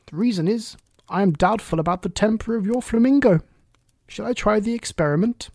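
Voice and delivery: put-on a chatty, fussy old duchess. Duchess voiced in story.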